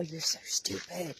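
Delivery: lightly voiced nagging inner voice, drawn out; inner critic. Little voice inside your head putting you down, mock scolding. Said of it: "a little voice inside your head".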